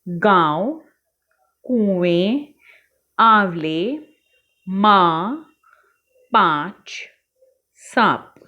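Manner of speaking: each word set apart with a light nasal vowel; clear chandrabindu words. Shown as a demonstration. Teacher modelling chandrabindu words for the class. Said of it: "चंद्र बिंदु है उन पर हमें गोला लगाना है".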